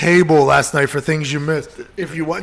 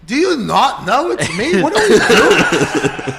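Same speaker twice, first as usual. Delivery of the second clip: laughing disbelief; 7 semitones higher, 4 dB louder, slower than usual. Retold or imitated. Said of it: "I'm like".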